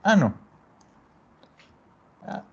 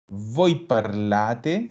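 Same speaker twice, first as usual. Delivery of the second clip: parlate slowly spelled out with the weight on la; stress on la; 4 semitones lower, much slower than usual. Shown as a demonstration. Teacher showing where the stress falls, slow and deliberate. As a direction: voi parlate, very slow, leaning on the la of parlate. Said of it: "siempre va a cargar acá".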